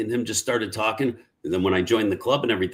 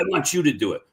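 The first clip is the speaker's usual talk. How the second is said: retold insistence; 6 semitones higher, faster than usual. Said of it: "he hulked up on me".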